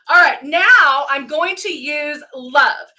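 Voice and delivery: ordinary delivery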